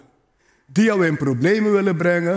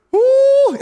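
excited cry; 7 dB louder than usual. Real, not acted. Excited preacher exclaims.

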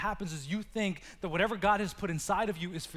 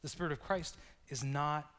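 normal talking voice

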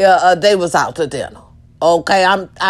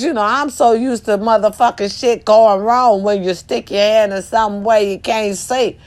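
normal talking voice

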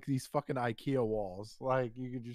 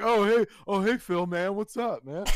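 hearty greeting; 6 semitones higher, 6 dB louder than usual. Retold or imitated. Buddy greets him through thin wall.

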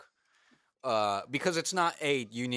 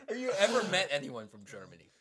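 laughing; 5 semitones higher than usual. Real, not acted. Laughing over bad accent.

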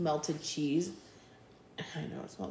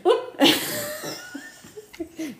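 loud, very high peal of laughter; high laughing outburst; 5 dB louder than usual. Real, not acted. Teasing co-host about crying at wedding, high laughter. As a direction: laugh long, pitched way up. Laughing through farewell sadness.

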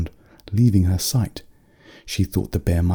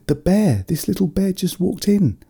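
put-on young girl voice; 12 semitones higher than usual. A narrator's reading. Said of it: "she said".

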